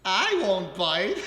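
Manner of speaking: ordinary talk, nothing marked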